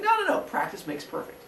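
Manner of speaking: high no's then a hushed aside. Retold or imitated. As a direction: protest high, then drop to a hush. Mother Earth reassures husband.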